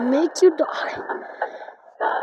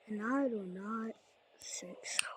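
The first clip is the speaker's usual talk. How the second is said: menacing whisper; 6 semitones lower, 18 dB quieter than usual. Acted. Menacing whisper at game foe.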